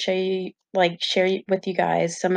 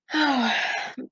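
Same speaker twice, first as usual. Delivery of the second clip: long, soft venting sigh; exasperated sigh; 6 semitones higher, 4 dB quieter than usual. Real, not acted. After venting about the worst symptoms, exasperated sigh. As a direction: let out a real, weary sigh.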